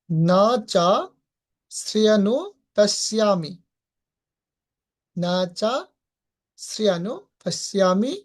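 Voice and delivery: slow syllable-by-syllable recitation; broken into syllables. Shown as a demonstration. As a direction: spell the verse out piece by piece. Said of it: "ভেঙে ভেঙে উচ্চারণ করার চেষ্টা করব".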